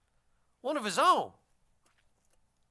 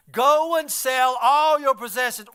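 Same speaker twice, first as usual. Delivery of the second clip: louder and slow, leaning on all; firm command; 2 semitones higher, 7 dB louder, slower than usual. Acted. Preacher as Jesus, loud and slow command. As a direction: pronounce it like a hard order.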